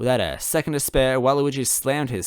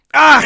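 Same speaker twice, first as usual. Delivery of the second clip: startled scream; 15 dB louder than usual. Acted. Bomb blast.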